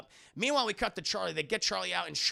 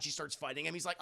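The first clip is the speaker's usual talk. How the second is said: ordinary delivery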